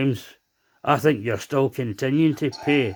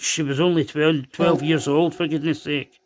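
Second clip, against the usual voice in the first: normal talking voice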